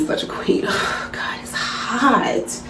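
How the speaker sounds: breathy whisper about the heat; unvoiced sigh, it's hot. Real, not acted. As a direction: whisper it, overheated.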